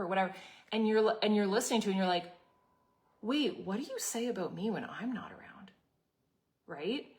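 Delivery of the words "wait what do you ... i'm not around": hushed suspicious whisper. Retold or imitated. Listener of gossip wondering what's said about her, whispered. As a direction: breathe it like a wary aside to yourself. Inner thought hearing gossip.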